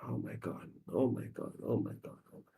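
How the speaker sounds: low, soft murmur to himself; subdued, sinking repetition; hushed dismay. Acted. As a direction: quietly dread the fighting at home. Acted boy hearing parents fight at home.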